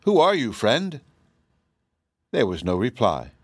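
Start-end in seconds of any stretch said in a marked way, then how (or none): 0.0-1.0 higher, shaky with emotion; trembling voice. A narrator's reading. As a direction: let the voice tremble. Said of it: "a voice that trembled with emotion".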